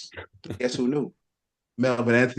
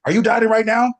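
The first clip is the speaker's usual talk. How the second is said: higher, louder imitation of Lee Priest's accent; 10 semitones higher, 6 dB louder than usual. Lee Priest's accent, offering the fridge to Melvin, higher and louder. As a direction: bodybuilder mimicking his friend's accent, punchy and loud. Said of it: "Lee Priest in his accent".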